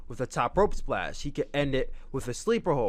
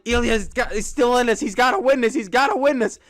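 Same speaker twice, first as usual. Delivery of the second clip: louder, higher urgent cheering; frantic excitement; 8 semitones higher, 6 dB louder than usual. Retold or imitated. Fan reliving the match, loud and higher with excitement. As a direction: urge him on like a desperate fan. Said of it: "I was losing my shit".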